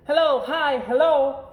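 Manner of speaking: high, bright little cub voice, greeting everyone; cheery little girl. Acted. Little Sister greeting every passerby, cheery high girl voice. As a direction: sing out hello to every passerby.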